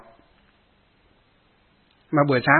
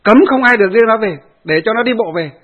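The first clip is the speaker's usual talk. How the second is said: loud, higher furious order; retold angry shout; 6 semitones higher, 6 dB louder than usual. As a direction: yell the order after him. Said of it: "chú này còn chạy ra tận sân".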